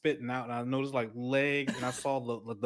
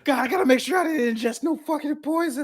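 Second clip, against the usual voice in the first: much higher, louder frantic mock worry; retold panic; 14 semitones higher, 8 dB louder than usual. Retelling fear of swallowing spider poison, frantic high yelling. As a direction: rush it out, hammed-up panic. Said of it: "I was like".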